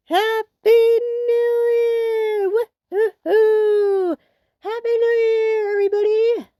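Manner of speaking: high, slow joyful New Year shout; excited cheering. Real, not acted. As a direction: cheer it out at midnight. Midnight strikes, cheering.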